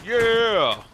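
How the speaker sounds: loud happy yell; excited cheer. Real, not acted. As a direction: whoop it out. Cheering after reaching objective.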